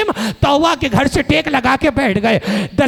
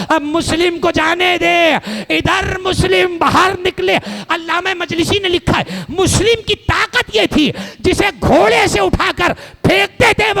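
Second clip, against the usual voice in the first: wailing sermon lament; 5 semitones higher than usual. Acted. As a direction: stretch each word out in mourning. Majlis lament at full force.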